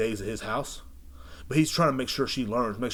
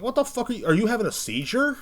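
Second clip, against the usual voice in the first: much higher, incredulous outburst; mock disbelief; 13 semitones higher, 4 dB louder than usual. Host asking the mumbling kid what he's doing, high incredulous outburst. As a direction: squeal it in disbelief.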